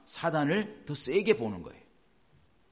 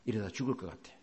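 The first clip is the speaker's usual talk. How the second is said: low, soft hopeless quote; despairing sick person; 6 semitones lower, 8 dB quieter than usual. Retold or imitated. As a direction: sigh it out like someone giving up on healing. Quoting a despairing sick believer. (낮고 힘없이 절망하는 말투)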